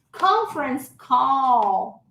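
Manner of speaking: "Conference call" very slow and evenly spaced; "call" lengthened with a sounded L; model for repetition. Shown as a demonstration. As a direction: draw out "Conference call" for students to copy.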